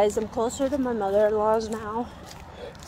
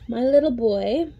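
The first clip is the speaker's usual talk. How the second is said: ordinary delivery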